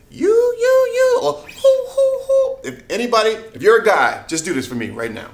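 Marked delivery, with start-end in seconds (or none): 0.1-2.6 high, loud falsetto syllables; falsetto you and hoo. Shown as a demonstration. Coach giving guys falsetto syllables to try. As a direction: pop them up in head voice. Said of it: "if you can go".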